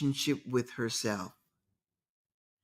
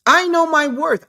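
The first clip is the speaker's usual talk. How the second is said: emphatic declaration; 11 semitones higher, 6 dB louder than usual. Real, not acted. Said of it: "preaching self-worth".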